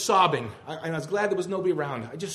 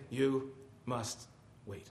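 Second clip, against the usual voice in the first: quiet, solemn reply; 7 dB quieter, much slower than usual. Acted. The Master replying once again to the believer, quiet and solemn. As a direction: drop soft and space out each word. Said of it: "my master replied once again".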